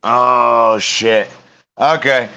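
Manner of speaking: normal talking voice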